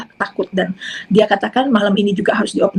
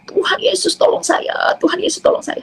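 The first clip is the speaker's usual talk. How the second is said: retold crying plea; 9 semitones higher than usual. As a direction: re-enact crying out to Jesus in pain, voice pitched way up. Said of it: "saya nangis, saya teriak".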